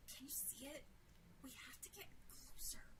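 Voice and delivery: soft, tense whisper to a companion; whisper, keeping unheard. A narrator's reading. Sarah to Jill in the dark yard, whispering. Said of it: "Sarah whispered".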